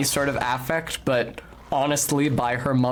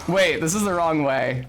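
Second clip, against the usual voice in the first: ordinary delivery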